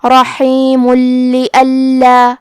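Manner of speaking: slow chanted Quranic phrase with the stop on رحیم; drawn-out Arabic recitation of رحیم لئلا; recited demo. Shown as a demonstration. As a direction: recite رحیم لئلا slowly, stopping on the مim of رحیم. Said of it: "ہم کلمت رحیم پہ رکے تو میم پہ جزم سے رکیں گے".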